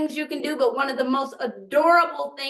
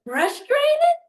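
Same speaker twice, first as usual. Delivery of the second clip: put-on gentle question to toddler; 8 semitones higher, slower than usual. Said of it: "I'm like".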